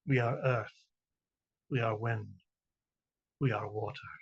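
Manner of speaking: measured, deliberate poetry recital with long pauses; reading a short poem to an audience, spaced and solemn. Acted. Reads her poem aloud.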